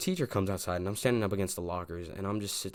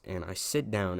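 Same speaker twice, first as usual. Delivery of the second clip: ordinary talk, nothing marked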